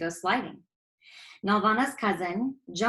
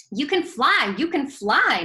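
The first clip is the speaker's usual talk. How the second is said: excited; 6 semitones higher, 5 dB louder than usual. Acted. Girl cheering friend flying.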